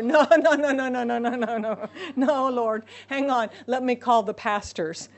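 loud panicky no's that settle down; frantic refusal. Acted. Believers dodging God's call, mock panicked refusal. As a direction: back away from the call in a comic panic.

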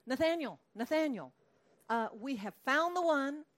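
high, loud eager calling of a name; excited calling. Acted. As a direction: call him over, bursting with the news. Philip calling excitedly to Nathanael.

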